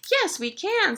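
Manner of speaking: high, keen puppy voice; high-pitched happy dog; eager dog. Acted. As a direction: agree as the enthusiastic dog. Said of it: "says the dog".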